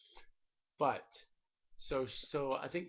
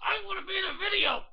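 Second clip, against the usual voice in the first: put-on whiny kid voice; 14 semitones higher, 6 dB louder than usual. Dad whines to be in video.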